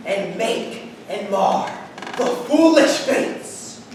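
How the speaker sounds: booming tyrant rant; lofty tyrant ranting. Acted. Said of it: "This was lofty".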